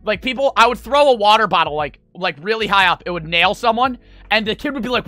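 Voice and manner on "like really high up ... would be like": ordinary talk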